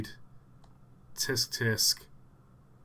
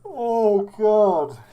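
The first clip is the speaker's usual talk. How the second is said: loud high oh God; shocked exclamation; 8 semitones higher, 11 dB louder than usual. Real, not acted. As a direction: blurt it out in shock. Shocked at melting model.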